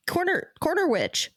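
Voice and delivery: high cackly witch voice. Pretend. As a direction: croak it like an old hag. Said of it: "Were you trying to do a witch laugh?".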